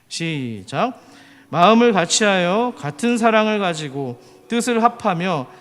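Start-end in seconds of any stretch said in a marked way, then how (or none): 1.5-5.5 loud, measured recitation of a Bible verse; sustained, declaimed verse reading; scripture reading. Acted. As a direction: lead the congregation in reading aloud. Leads a scripture reading aloud.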